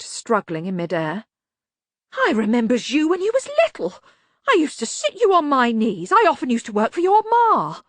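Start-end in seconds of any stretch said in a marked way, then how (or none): 2.1-7.8 high, loud, desperate wheedling; pleading locksmith. A narrator's reading. Locksmith reminding Banjo of childhood, high frantic pleading. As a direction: plead for your life in a high voice.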